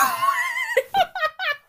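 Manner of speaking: high, loud burst of laughter; shrieking laugh. Real, not acted. Laughing at her own old story, a high shrieking laugh. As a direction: crack up helplessly.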